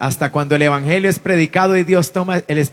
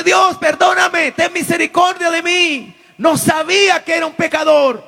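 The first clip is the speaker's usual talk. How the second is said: crying out for mercy; 12 semitones higher, 4 dB louder than usual. Retold or imitated. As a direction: cry out to God, begging. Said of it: "Y dice". (clamor alto y fuerte pidiendo perdón)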